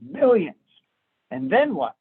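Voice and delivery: eyes lighting up at the word Millions; surprised exclamation. Acted. Italian hears he could make millions.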